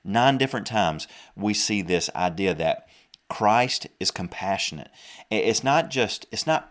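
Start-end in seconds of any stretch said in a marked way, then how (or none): none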